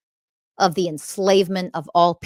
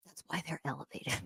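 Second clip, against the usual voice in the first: soft pointed whisper; 4 semitones lower, 18 dB quieter, faster than usual. Real, not acted. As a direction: lean in and whisper it. Pointed aside to commenters.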